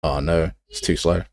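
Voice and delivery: normal talking voice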